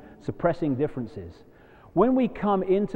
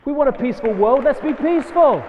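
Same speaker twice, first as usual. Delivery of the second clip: louder and higher, fast; rousing call; 9 semitones higher, 5 dB louder than usual. Real, not acted. Urging the audience to be peaceful, loud and rising. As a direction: lift the crowd toward peace.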